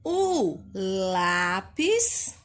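"O" said as a short U, "u lápis"; slow, read "O lápis" with the O as U; drawn-out model reading, "u lápis"; O sounds like U. Shown as a demonstration. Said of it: "então vai ficar como?".

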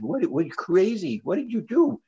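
mock astonished pastors asking what they did; incredulous clergy. Clergy aghast at the two.

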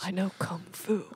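low, hushed whisper; secretive whispered echo; whispered movie quote. Acted. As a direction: whisper the film line under your breath. Echoing a famous movie line.

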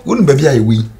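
loud, high-pitched indignant rant; worked-up, forceful complaint; heated protest. Acted. Heated argument with the other speaker.

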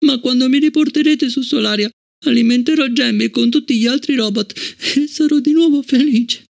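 put-on a girl close to tears, hoping; a touch of sobbing. Fallon longing for Solaria, lightly sobbing high child voice. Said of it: "Ora singhiozzava".

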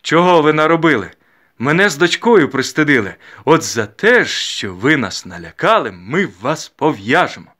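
loud scolding, drawn out toward the end; blustering reproach. A narrator's reading. Father sentencing matchmakers to be bound, loud bluster. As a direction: pronounce judgment with mock severity.

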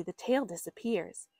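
usual speaking voice

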